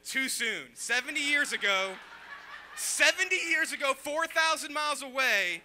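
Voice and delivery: mock indignation. Comic to a crowd balking at his joke, loudly indignant. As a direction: berate the crowd in loud disbelief. Scolding audience that balked at joke.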